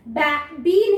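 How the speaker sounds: बे said as short "ba" as in bat, then बी; wrong reading of बे, बी with short flat A vowel; short A mispronunciation. Shown as a demonstration. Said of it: "नहीं पढ़ा है". (बे को छोटी "बै" आवाज़ में, फिर बी)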